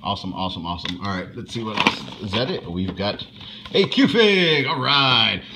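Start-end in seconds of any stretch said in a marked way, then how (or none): 3.7-5.4 loud, high excited announcement. Real, not acted. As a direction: burst out with excitement. Excited at unboxing a figure.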